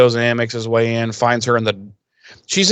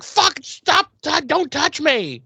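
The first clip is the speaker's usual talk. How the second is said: much higher panicked outburst; freaking out; slower than usual. Retold or imitated. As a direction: shriek it like a pregnant woman panicking at a stranger's touch. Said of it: "Cordy freaks".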